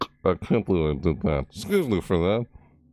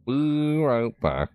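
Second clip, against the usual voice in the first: slow, stretched and higher; drawn out; 6 semitones higher, much slower than usual. Acted. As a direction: stretch every word out. Repeated drawn out.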